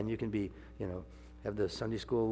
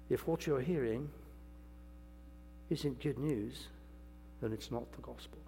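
put-on English accent; 3 semitones higher, 6 dB quieter than usual. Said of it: "whether I can do the accent or not".

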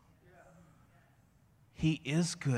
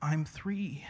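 higher, softer imitated toddler; little boy; 5 semitones higher, 7 dB quieter than usual. Three-year-old answering upset dad, small helpless voice. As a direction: play a sheepish small child, haltingly. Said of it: "And he's like".

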